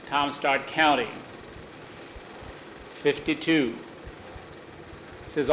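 plain reading voice

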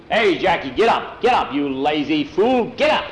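loud shout up at the loft; yelling. Acted. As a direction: bark him out of bed, loud. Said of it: "he'd hear Pedro yelling".

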